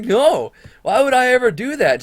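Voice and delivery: high, loud mock-indignant denial; feigned outrage. Student denies selling to principal, exaggerated high indignation. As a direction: protest it as if scandalized. Acting innocent before the principal.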